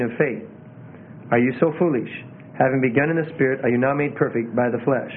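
lifeless, low monotone Bible reading; no feeling at all. Shown as a demonstration. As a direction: read it dead, with no spirit. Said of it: "read it with no feeling at all".

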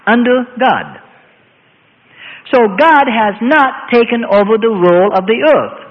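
slow, emphatic pulpit delivery; preaching. Acted. Preacher declaring man's rule over the earth, slow and emphatic. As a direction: lay it down word by word like scripture.

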